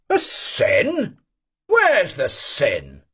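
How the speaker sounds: a high leap on the first sin, then slow and weighty; emphatic. Acted. Old man insists to the cadet, emphatic and slow. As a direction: press the point hard. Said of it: "said the old man emphatically".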